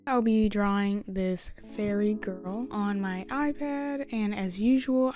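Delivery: normal talking voice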